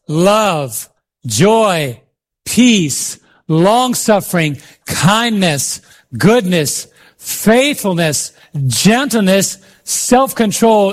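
one word at a time, with pauses; spaced-out, emphatic scripture list; measured group recitation. Real, not acted. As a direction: lead a congregation through the list. Leads congregation reading the list together.

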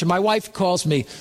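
normal talking voice

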